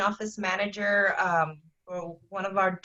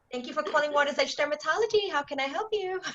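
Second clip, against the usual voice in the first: higher, cheery receptionist greeting; smiling phone greeting; 8 semitones higher than usual. Shown as a demonstration. Office manager shows the front desk a smiling greeting. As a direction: beam through the phone line. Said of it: "You need to smile when you pick up that phone".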